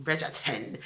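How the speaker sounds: soft mock-British echo; hushed fake British accent, amused. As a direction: echo the name in a bad British accent, quietly. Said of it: "Your, your British accent".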